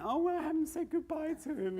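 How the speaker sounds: high mock-wail; mock screaming mum, high. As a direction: lightly wail like the parents.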